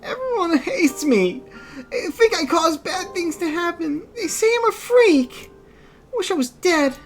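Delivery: put-on girl crying. Kaine crying to her grandmother, high and tearful. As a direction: say it through tears like a hurt girl. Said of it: "Kaine's tears turned to sobs".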